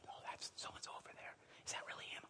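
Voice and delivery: whisper. Retold or imitated. Spotting a celebrity in a restaurant.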